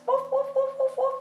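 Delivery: 1 puppy barks. Pretend. Puppy barking for kids.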